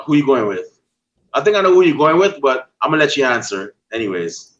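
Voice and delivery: ordinary talk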